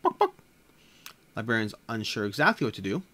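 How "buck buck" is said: high squawky cluck, two quick beats; chicken clucking. Acted. Chicken clucking at the librarian, high hen cluck. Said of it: "walks up to the librarian and says".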